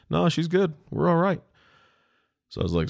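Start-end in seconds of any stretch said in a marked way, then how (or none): 0.1-1.4 much higher brushing-off husband; imitated carefree man; dismissive husband. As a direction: shrug off the questions.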